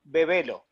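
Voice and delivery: Bebelo with clear Spanish e vowels, BE-BE-LO; Spanish pronunciation. Shown as a demonstration. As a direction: say Bebelo the Spanish way, crisp open e sounds. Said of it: "it's actually said Be".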